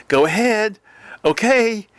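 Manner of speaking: light imitation of a sister; imitating sister. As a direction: toss it off like the sister on speakerphone. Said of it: "my sister going".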